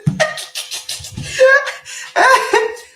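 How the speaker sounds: a big, high, breathy burst of laughter; hearty laugh. Real, not acted. Host laughing out loud at a viewer's joke. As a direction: laugh out loud at a chat comment. Real laugh during live chat.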